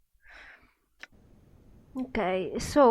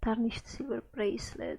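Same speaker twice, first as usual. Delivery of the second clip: whispering; 7 dB quieter than usual. Real, not acted. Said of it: "whispering to herself".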